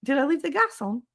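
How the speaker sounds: high, rising squirrel panic; a squirrel realizing it left the gas on; panicking squirrel. Retold or imitated. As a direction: squeal it as a squirrel in sudden alarm. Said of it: "they're like".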